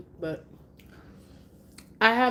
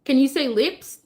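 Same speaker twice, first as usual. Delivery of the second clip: high, louder singsong question; coaxing teacher; 7 semitones higher, 5 dB louder than usual. Shown as a demonstration. Mom coaxing her baby to say lips. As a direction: coax him gently.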